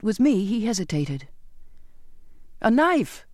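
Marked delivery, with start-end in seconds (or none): 2.6-3.2 high-pitched, restrained cry; cried out. A narrator's reading. Sailor asks captain for a knife, high cry. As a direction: call it out high over the wind. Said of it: "I cried".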